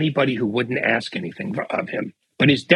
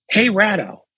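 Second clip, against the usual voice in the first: retold yell; 7 semitones higher than usual. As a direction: imitate him yelling across the room, reined in. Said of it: "he yells".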